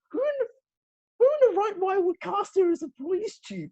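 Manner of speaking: high, disbelieving question; incredulous. Real, not acted. Asking the co-host about the casting, high and incredulous.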